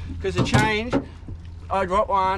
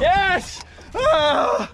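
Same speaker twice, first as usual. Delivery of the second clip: a high, loud yes of real joy; high-pitched yelp of delight; excited yell; 9 semitones higher, 3 dB louder than usual. Real, not acted. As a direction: cheer the catch. Fish landed after long week.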